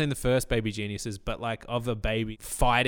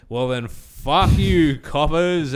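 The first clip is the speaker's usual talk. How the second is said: loud, slow defiant jeer; defiant yell; 5 semitones higher, 8 dB louder, much slower than usual. Pretend. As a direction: drag out the insult with a laugh. Telling off cops.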